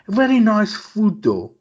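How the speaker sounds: high, loud, slow, savouring the words; mock Patrick. Patrick praising Moroccan food, high and loud, slow. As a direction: drawl it out like a cheeky excuse.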